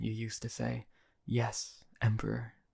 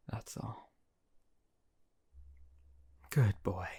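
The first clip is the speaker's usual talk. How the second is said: whisper. Acted. Emperor dismissing his servant with a whisper. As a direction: let it fall to a whisper.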